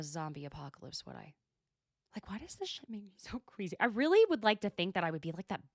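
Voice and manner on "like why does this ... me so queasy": whisper. Real, not acted. Aside while watching gross scene.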